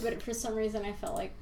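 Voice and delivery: ordinary talk, nothing marked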